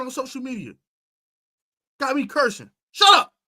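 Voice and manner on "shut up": high, loud snapped shut up; sharp shout. Real, not acted. Host yelling at the player to shut up. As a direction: cut him off hard.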